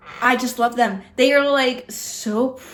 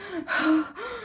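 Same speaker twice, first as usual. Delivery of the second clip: demonstrated winded breathing; heavy breaths, as if after climbing stairs; deep breaths; 5 semitones higher, 6 dB quieter than usual. Shown as a demonstration. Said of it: "I was taking some deep breaths".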